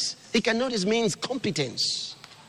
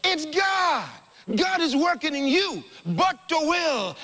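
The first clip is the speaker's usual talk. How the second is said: excited shout; 8 semitones higher than usual. Real, not acted. Preacher shouting it to the congregation. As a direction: burst out with it at full voice.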